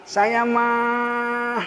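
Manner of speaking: slow, soft mock-gentle voice; drawled soft Sundanese-style aside; soft gentle preacher. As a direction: imitate a meek, mild preacher. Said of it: "Ada yang lembut, kayak Agib".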